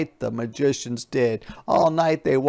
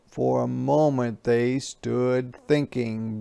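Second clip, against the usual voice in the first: plain reading voice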